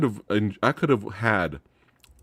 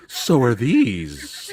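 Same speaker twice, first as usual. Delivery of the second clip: slow mock seduction; 3 semitones higher, much slower than usual. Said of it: "She's like".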